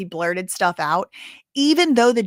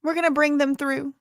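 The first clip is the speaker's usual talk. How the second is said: interrupting judge; 6 semitones higher than usual. Retold or imitated. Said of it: "So the judge says".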